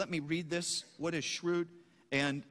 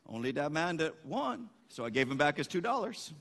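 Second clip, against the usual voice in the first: lower, put-on Cajun accent; 6 semitones lower than usual. Boudreaux explains the refund to the farmer, Cajun accent. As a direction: deliver the punchline in a mock Cajun accent. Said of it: "I won't get the right accent".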